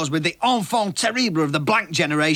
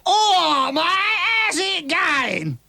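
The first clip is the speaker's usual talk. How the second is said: high, slow put-on cockney greeting; 11 semitones higher, much slower than usual. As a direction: ham up a London geezer's hello. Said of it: "how the bloody hell are you".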